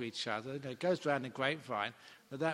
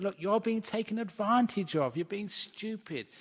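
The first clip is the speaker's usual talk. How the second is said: louder, higher stern scolding from the abbot; 8 semitones higher, 7 dB louder than usual. Retold or imitated. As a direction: tell him off like a head monk. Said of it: "gave him a scolding".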